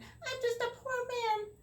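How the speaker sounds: high, slow whine; whining leprechaun. Acted. The caught leprechaun whines to Tom in a high slow voice. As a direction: plead like a wriggling wee man. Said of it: "wiggled and twisted and whined".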